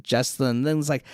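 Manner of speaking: ordinary delivery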